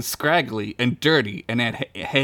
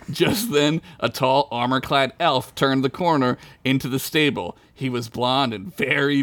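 ordinary talk, nothing marked

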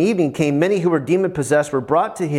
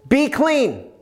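high, emphatic command voice; firm command; 9 semitones higher than usual. Retold or imitated. Jesus to a man with leprosy, firm command. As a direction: pronounce it with authority. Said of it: "he just commanded his body".

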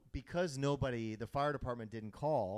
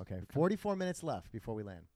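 ordinary talk

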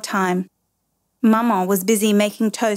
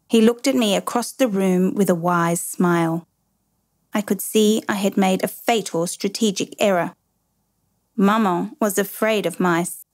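ordinary delivery

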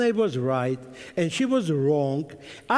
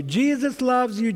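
pitched up and louder, pressing the words; begging him to hear it, higher; urgent raised plea; 7 semitones higher, 4 dB louder than usual. Retold or imitated. Wife pleading with her husband, higher and louder.

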